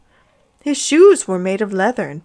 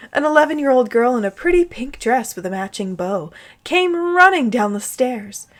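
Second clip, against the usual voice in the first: normal talking voice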